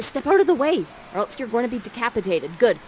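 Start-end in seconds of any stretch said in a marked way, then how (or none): none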